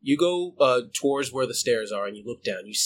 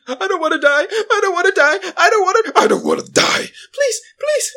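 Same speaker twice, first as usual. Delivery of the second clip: loud, very high panicked wail; acted crying out in terror; terrified pleading; 7 dB louder than usual. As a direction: beg hysterically, voice shooting up. Character pleads for his life.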